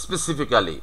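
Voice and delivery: "specifically" as spesi-fi-KAA-li; every written syllable of "specifically" sounded; local "specifically". Shown as a demonstration. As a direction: say "specifically" with a full "kaa" before "li". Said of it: "আমরা কিন্তু এটাই বলে থাকি".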